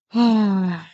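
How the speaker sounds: loud, heavy sigh; exasperated sigh. Real, not acted. Streamer about to reconnect the iPad, loud exasperated sigh. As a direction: let out a big exasperated sigh.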